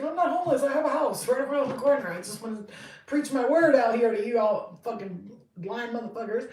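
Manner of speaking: high, loud, laughing protest; laughing loud retort. Retold or imitated. Reader yelling back at mocking onlookers. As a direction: yell back at the crowd.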